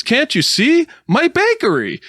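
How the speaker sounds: high, loud, dismayed wail; wailing baker. Pretend. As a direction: cry out over the ruined bakery. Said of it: "And he says".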